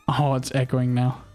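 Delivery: ordinary talk, nothing marked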